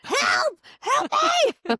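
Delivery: high, loud shouted help; mock cry for help. Guest opening the show with a shouted cry for help. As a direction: yell for help like a trapped patient.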